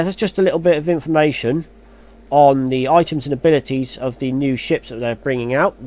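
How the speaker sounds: usual speaking voice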